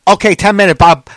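loud, high mock clerk; impatient takeout worker impression; rude order-taker. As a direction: rush them off the phone loudly. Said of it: "they're so fucking rude".

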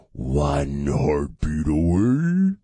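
very slow, low, rising at the end; hammed-up spooky drawl with a rising finish; exaggerated mock ominous. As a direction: stretch every word, swooping up on AWAY. Ominous line repeated, exaggerated.

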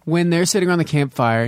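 ordinary talk, nothing marked

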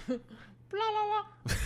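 high, badly faked rooster crow; a half-hearted try at crowing like a rooster. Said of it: "It's my rooster noise".

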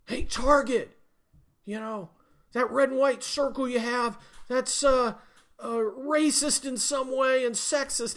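hammed-up prissy accuser; mock activist complaint. Scene: an activist accuses Target of racism, mock high whine.

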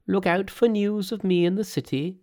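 high, emphatic Monkey voice; instructing Monkey. Acted. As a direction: give magic instructions in a high, sure voice. Monkey calls instructions to priests.

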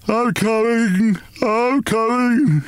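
high, slow, drowsy wail; slow sleepy wail. Acted. Father waking groggily, answering the noise in a slow sleepy wail. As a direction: drag the words out, half asleep. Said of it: "he wailed in a slow, sleepy voice".

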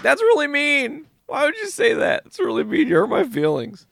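mock hurt teenager whining; whiny hurt kid. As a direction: sulk like a wounded kid. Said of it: "Ben's like".

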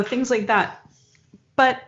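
ordinary talk, nothing marked

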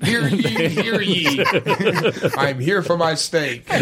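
high herald voice, laughing through; town crier. Retold or imitated. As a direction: proclaim it like a giggling herald. Joins the mock town crier bit.

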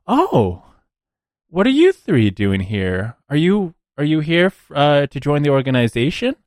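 a hint of a friendly recruiter greeting strangers; lightly voiced recruiter. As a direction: put on a light businesswoman's welcome.

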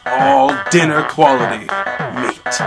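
ordinary talk

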